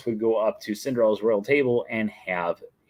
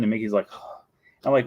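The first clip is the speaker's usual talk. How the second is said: ordinary talk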